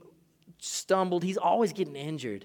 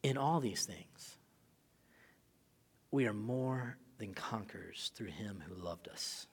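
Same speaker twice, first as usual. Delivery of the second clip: soft, breathy reading of scripture; hushed scripture; 7 semitones lower, 9 dB quieter than usual. Acted. Preacher reading Romans eight to the congregation, hushed. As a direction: let the verse fall to a hush.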